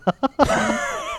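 very high squeal of laughter rising. Real, not acted. Laughing at car lurching. (尖声笑，尾音上扬)

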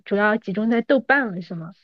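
normal talking voice

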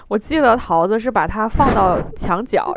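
ordinary delivery

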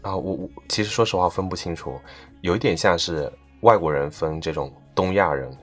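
ordinary talk, nothing marked

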